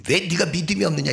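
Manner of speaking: high-pitched, sharp scolding; acted rebuke. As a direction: fire the reproach at a doubting follower. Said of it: "이 거친 말 속에". (높은 목소리로 꾸짖듯이)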